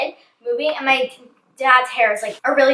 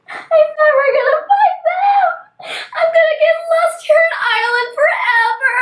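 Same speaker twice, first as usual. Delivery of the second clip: loud, very high imitation sobbing; hammed-up childish bawling; mock crying wail; 4 dB louder than usual. Lost girl crying alone on the trail, wailing in mock despair. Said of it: "started crying like this".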